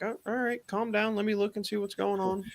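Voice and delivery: placating. Retold or imitated. Technician to panicked homeowner, placating.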